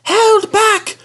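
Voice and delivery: very high squeaky held back; high conspiratorial squeak; whispered coach voice. A narrator's reading. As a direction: pass the secret like a giggling coach. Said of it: "whispered Agnes to Ruth".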